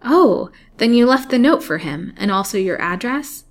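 lifted, hopeful questioning. A narrator's reading. Captive girl asks if her note was left, hopeful. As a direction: start high on a hopeful "oh". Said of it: "in a more hopeful tone".

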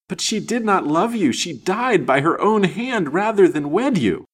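high, loud, vehement objection; impassioned retort. A narrator's reading. Spurned lady arguing his lost love refused him, impassioned retort. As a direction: throw it back at him forcefully.

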